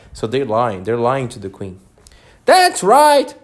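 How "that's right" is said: high, loud royal approval; shouted. A narrator's reading. The Queen shouts back to her soldiers. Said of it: "shouted the queen".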